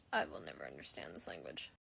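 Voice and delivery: very low, quiet, creaky deadpan; Cass voice. Acted. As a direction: mutter it low and fast as baffled Castiel. Plays Cass baffled by Dean's wording.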